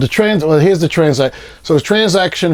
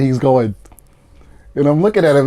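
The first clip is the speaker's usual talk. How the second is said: ordinary delivery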